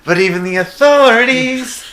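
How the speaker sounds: high, slow cartoon voice; South Park character voice. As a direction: squeak it out like a cartoon kid. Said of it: "You know what mine was?".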